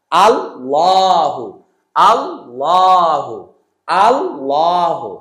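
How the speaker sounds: আল্লাহু with a thick, dark ল, slow and drawn out; heavy L in Allahu. Shown as a demonstration. Teacher drawing out আল্লাহু for pupils with a heavy lam. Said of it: "শুধু লামটা মোটা হবে".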